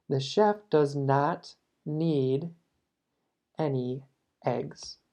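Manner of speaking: slow, careful English read; slow model sentence. Shown as a demonstration. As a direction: teach it word by word. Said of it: "Voy a repetir eso". (frase modelo, lenta)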